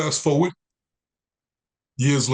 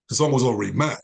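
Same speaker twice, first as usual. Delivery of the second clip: ordinary talk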